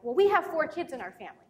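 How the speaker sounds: imitating a proud son; proud child. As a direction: announce it proudly as a child would. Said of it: "very proud about it".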